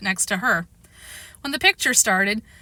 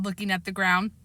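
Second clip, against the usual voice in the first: plain reading voice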